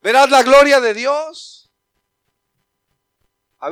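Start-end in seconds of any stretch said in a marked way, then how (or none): 0.0-1.7 slow, loud, higher rebuke; shout. Retold or imitated. Jesus shouting at Martha, slow loud shout. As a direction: cry out the promise to her. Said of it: "Jesús le tuvo que gritar".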